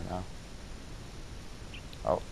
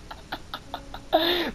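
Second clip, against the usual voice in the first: high-pitched laugh; 7 dB quieter than usual. Real, not acted. Laugh after loss.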